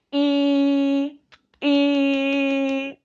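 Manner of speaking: a long I said with a pencil gripped between the teeth; vowel I with pencil. Shown as a demonstration. Teacher showing students the closed vowel, pencil in teeth. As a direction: hold the I, teeth biting the pencil. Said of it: "Colocamos el lapicero".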